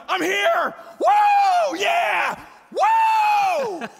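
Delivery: very high, loud whooping; excited kid shouting. Acted. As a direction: shriek it like a kid bursting into church. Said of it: "first thing that they do is go".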